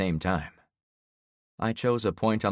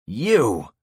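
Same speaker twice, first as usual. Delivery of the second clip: furious loud outburst; raging; 5 semitones higher, 7 dB louder than usual. Acted. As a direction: spit it out in rage. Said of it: "he raged".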